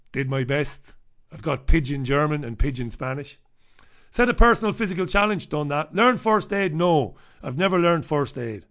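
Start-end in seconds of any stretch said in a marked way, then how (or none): none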